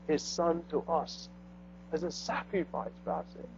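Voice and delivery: low, soft and subdued, almost whispered in places; hushed confession. Acted. Preacher voicing the congregation's confession, hushed and low. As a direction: confess it quietly, head bowed. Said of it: "we have to say".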